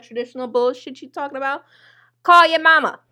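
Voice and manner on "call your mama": high, loud sarcastic order; a loud jab telling the man to call his mama; loud mock command. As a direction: bark it at him, loud and high. Repeated punchline, first take.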